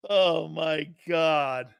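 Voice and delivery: usual speaking voice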